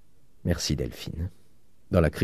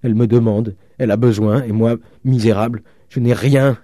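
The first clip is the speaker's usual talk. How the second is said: high, loud, broken into short bursts; anguished despair; 4 semitones higher, 6 dB louder than usual. A narrator's reading. Penniless father failing his daughter, anguished. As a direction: cry out in helpless self-reproach.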